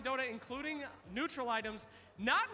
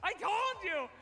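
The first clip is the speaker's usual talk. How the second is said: excited told-you-so; 6 semitones higher, 4 dB louder than usual. Real, not acted. Host reacts to contestant knowing.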